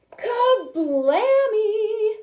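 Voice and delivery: high, loud, drawn-out kablammy; explosion. A narrator's reading. Storyteller acting out the explosion, high and loud. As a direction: make it boom.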